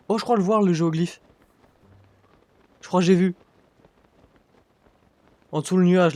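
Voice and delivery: loud, excited discovery. Real, not acted. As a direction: call it out thrilled. Spots geoglyph in game.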